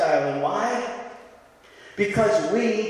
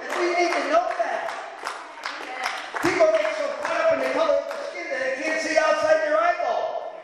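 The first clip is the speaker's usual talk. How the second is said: fiery preaching; 6 semitones higher than usual. Real, not acted. Pastor crying out to the church, high preaching shout. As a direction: hammer it home to the congregation.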